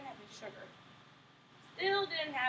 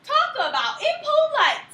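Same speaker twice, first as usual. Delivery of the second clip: offended huff; 11 semitones higher, 10 dB louder, slower than usual. Acted. Wolf offended.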